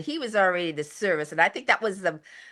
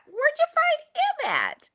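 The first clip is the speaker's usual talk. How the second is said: mock family question.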